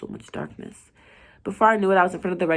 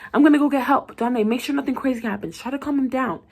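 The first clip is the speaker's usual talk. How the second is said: loud, higher hurried orders; urgent call; 7 semitones higher, 4 dB louder than usual. Acted. Colleague running for help, calling out loud. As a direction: shout it over your shoulder.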